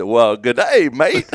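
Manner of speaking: loud, hammed-up Aussie greeting, rising high; mock Australian accent. Co-host quoting Dumb and Dumber, hammed-up Australian accent. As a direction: play a clueless bad Aussie accent. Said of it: "He said".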